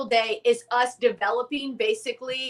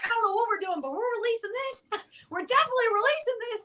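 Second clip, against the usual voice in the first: excited; 11 semitones higher, faster than usual. Retold or imitated. Freaking out with dad.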